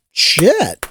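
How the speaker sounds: normal talking voice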